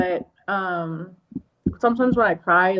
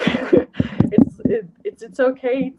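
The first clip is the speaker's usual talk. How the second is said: choked up; 7 semitones higher, 5 dB louder, much slower than usual. Real, not acted. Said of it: "I'm getting very emotional".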